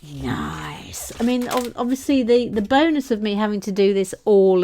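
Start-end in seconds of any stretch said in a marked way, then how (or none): 0.0-1.1 soft, low, drawn-out murmur; jazz club catchphrase. Acted. Host echoing the jazz club cue, soft low drawl. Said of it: "Now to the jazz club".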